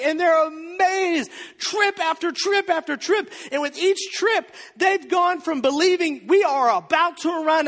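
high, loud, driving sermon voice; fired-up preaching. Acted. Preacher building the miracle story for the church, loud and fired up. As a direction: preach it with rising excitement.

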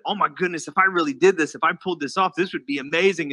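ordinary talk

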